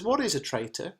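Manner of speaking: high, lightly acted child's question. A narrator's reading. Son asks mother what a word means, questioning child. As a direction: lift the pitch for a curious young son.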